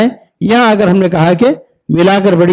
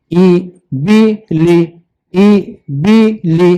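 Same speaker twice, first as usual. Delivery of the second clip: slow syllable-by-syllable demo; 3 semitones lower, much slower than usual. Shown as a demonstration. Teacher sounding out syllables for a pupil, slowly. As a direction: split it into slow separate syllables. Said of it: "जैसे".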